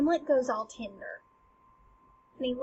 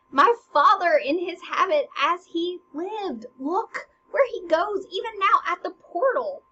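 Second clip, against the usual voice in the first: raised, loud dramatic declaiming; urgent Hamlet; 6 semitones higher, 6 dB louder than usual. Acted. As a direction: cry out as the ghost slips away. Hamlet cries out as ghost leaves.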